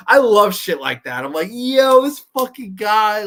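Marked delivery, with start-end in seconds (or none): 1.5-3.2 loud, high, stretched-out reaction; hyped-up outburst, pitched up; excited exclamation. Retold or imitated. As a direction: exclaim it like a thrilled fan, drawn out. Acting out own excited reaction.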